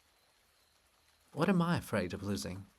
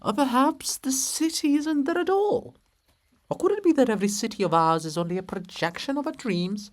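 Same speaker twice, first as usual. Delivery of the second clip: high, loud, wailing chorus; rising louder desperate chorus lines; 11 semitones higher, 7 dB louder than usual. Acted.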